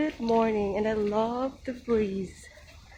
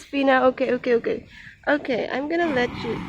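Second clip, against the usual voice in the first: ordinary talk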